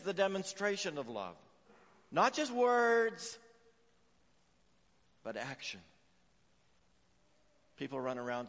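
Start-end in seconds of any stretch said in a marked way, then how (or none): 2.1-3.4 slow, loud and high, every word hammered; forceful preaching. Real, not acted. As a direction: drive the point home. Preacher's climax line.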